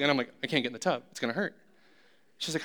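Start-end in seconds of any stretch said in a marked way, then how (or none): 0.4-1.6 soft, whimpering complaint; whiny sick husband. Retold or imitated. Husband stuck in the tub, whining softly to his wife. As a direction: plead like a scared kid.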